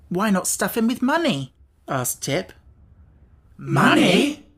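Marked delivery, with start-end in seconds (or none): 0.1-1.5 higher, eager suggestion; a bright idea; boy's idea. A narrator's reading. Tip suggests. 3.6-4.5 higher, louder astonished question; stunned by the idea; amazed cry. A narrator's reading. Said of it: "cried in an amazed chorus".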